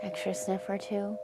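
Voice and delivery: soft, hushed breath-work cues with long pauses; guided breathing. Acted. Meditation teacher guiding the class through breaths, softly. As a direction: gently coach each inhale and hold.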